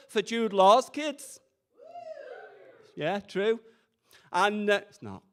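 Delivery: ordinary delivery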